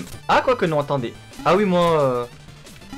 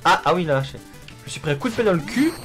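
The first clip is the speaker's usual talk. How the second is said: normal talking voice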